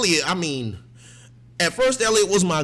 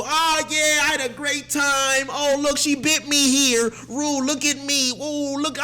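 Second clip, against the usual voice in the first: high, mocking boastful voice; high mock brag; mocking Jules; 9 semitones higher, 4 dB louder, slower than usual. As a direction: mimic her bragging, high and taunting. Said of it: "telling Rue".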